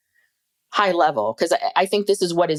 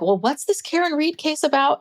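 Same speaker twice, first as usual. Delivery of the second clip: quoting husband in a higher put-on voice; imitating Steve; 10 semitones higher than usual. Steve asking his wife about the case, mildly voiced. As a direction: lightly play the puzzled husband asking.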